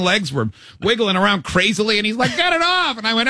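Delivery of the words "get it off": high-pitched, hammed-up squeal for help; imitating a frantic kid; mock panicked plea. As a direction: plead like you're pinned and flailing. Acts out pinned, flailing friend.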